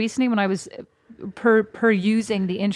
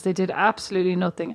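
ordinary delivery

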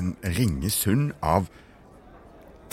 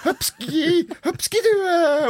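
much higher, squeaky greeting; high silly mother voice; 3 dB louder than usual. Acted. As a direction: play the mum as a high cartoon lady.

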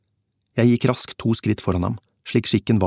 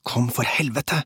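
hissed order, raised in pitch but held in; urgent hiss; 4 semitones higher, slower than usual. A narrator's reading. Kåre at the exit urging his partner to come, urgent hiss. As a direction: hiss it urgently without raising the volume. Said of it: "hveste Kåre ved utgangen".

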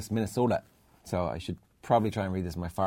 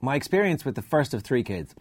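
higher, projected storytelling read; email read-aloud; 3 semitones higher than usual. Acted. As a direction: read the letter out with punch. Reads out listener email.

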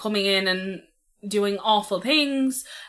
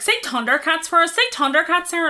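high, loud teasing plea; teasing coworkers; 8 semitones higher, 4 dB louder than usual. Retold or imitated. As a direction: egg her on to say the word, pitched up. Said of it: "they got really annoyed 'cause they were like".

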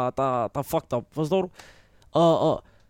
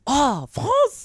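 imitating French woman; 8 semitones higher than usual. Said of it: "så siger hun".

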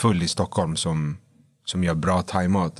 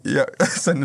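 usual speaking voice

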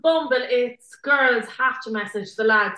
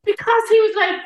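usual speaking voice

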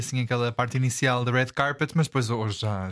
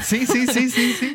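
excited; 11 semitones higher, 4 dB louder, slower than usual. Real, not acted. Eager agreement about dress.

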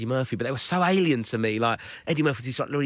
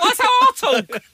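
laughing protest; 8 dB louder, slower than usual. Retold or imitated. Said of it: "I'm like".

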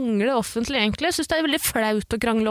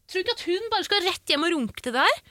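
usual speaking voice

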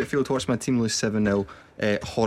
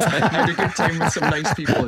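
laughing; 5 semitones higher, 5 dB louder than usual. Retold or imitated. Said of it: "I said".

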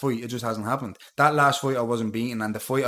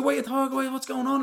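much higher, retold shocked question; shocked disbelief; 11 semitones higher, faster than usual. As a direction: replay the moment of total disbelief. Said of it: "Like, I was shocked".

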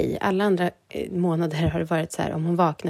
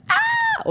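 playful imitated shriek. Mother playing back her baby's piercing scream, playful high shriek. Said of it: "hon har ju haft sitt gallskrik".

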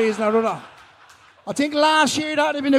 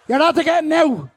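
loud call across the room; 5 semitones higher, 3 dB louder than usual. Real, not acted. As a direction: shout it out to the crowd. Calling out to the crowd.

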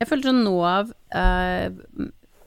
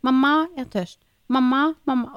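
put-on higher pleading child voice; whiny child; 5 semitones higher than usual. Mother imitating daughter calling mamma at bedtime. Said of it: "Kommer ut".

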